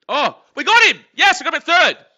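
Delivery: loud, high triumphant cry; jubilant shout. Real, not acted. Fan celebrating the out at third, loud and high. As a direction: celebrate the out at the top of your voice. Runner thrown out.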